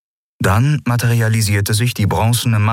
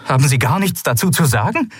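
challenging question; 8 semitones higher than usual. Acted. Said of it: "fragte Kalin".

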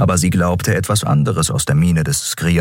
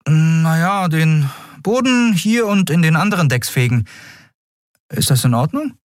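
higher-voiced captain giving orders; captain's higher, businesslike voice; 12 semitones higher than usual. A narrator's reading. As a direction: play a practical captain sorting things out. Captain hands out chores.

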